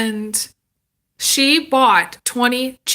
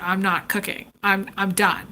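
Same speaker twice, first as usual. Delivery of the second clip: tired mom quote; 5 semitones lower, 6 dB quieter than usual. Retold or imitated. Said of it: "my mom was like".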